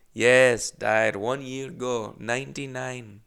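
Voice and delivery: slow imitated Russian accent; thick Russian accent. Old Russian lady explains aunt's death, slow imitated Russian accent. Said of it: "Very thick accent".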